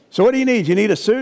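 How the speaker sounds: high, eager salesman pitch; slick salesman pitch. Retold or imitated. Salesman buttering up the son, eager and high. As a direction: sell the suit with oily eagerness.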